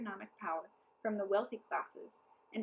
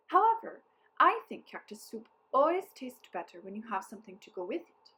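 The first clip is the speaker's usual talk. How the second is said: crafty captain hinting; 9 dB louder than usual. A narrator's reading. Captain voiced.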